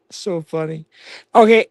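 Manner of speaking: ordinary delivery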